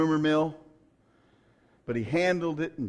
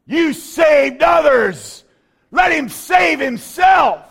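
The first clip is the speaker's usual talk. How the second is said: preaching at full force; 11 semitones higher, 15 dB louder than usual. Acted. Preacher to congregation, shouting mockers at the cross. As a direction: thunder it out at the climax.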